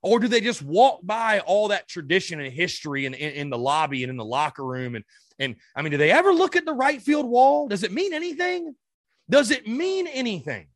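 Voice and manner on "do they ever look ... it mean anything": higher, louder angry demand; furious. Real, not acted. Fan venting at his team's players, angry higher shout. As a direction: fire it at the players, fed up. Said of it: "I'm fucking pissed".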